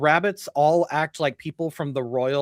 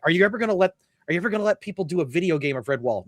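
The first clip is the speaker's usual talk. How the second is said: rushed interviewer; 3 semitones higher, much faster than usual. Retold or imitated. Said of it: "they're like".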